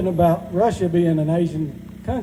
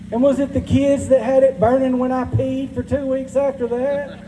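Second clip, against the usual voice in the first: higher, accusing southern wife voice; angry wife; 4 semitones higher than usual. Retold or imitated. Wife accusing husband Terry of cheating, in a mock interrogation. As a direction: grill him about every lie.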